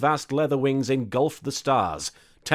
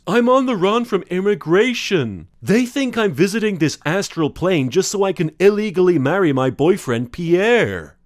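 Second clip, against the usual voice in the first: higher, louder flustered monster god; camp elder god; 6 semitones higher, 7 dB louder than usual. Acted. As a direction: confess it like a camp cosmic giant.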